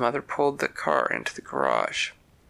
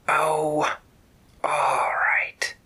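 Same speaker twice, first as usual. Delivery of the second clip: breathy hissed snake voice, a little distant; whispery snake; 2 semitones higher, much slower than usual. Acted. Snake agreeing to leave Terry's ladder, in a whispery hiss. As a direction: let the snake sigh it out in a hiss.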